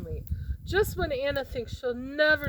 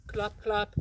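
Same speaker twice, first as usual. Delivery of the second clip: ordinary delivery, nothing marked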